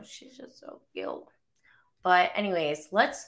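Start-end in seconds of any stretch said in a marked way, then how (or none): 0.0-1.2 whispered, soft and doting; hushed gushing over her little one; tender whisper. Real, not acted. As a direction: breathe it out like she's still asleep beside you. Gushing over her sleeping toddler.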